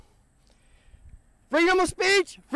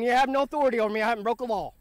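ordinary delivery

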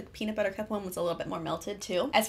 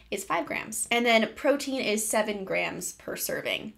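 usual speaking voice

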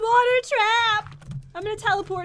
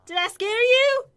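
ordinary talk

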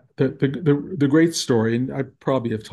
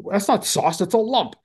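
higher-pitched mock rebuke; retold scolding; 7 semitones higher than usual. Teacher rejecting the lumpy sauce in the kitchen, retold scolding. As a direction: imitate a critic's indignant verdict, pitched up. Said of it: "he's yelling at me".

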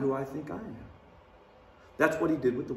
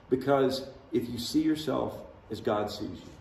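ordinary talk

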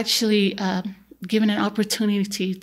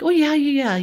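dismissive; 7 semitones higher, 4 dB louder than usual. Retold or imitated. Dad brushing off the question.

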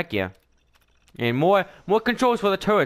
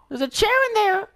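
excited exclaim. Real, not acted. Excited discovery.

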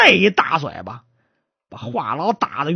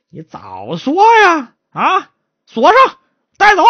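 exasperated command; 10 semitones higher, 5 dB louder than usual. Acted. Magistrate having the confessed guards locked up, exasperated. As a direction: draw out the orders with authority.

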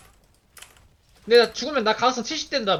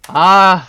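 much louder long groan; loud relieved groan; 2 semitones lower, 14 dB louder than usual. Real, not acted. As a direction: let out a big groan. Game over, loud exhale.

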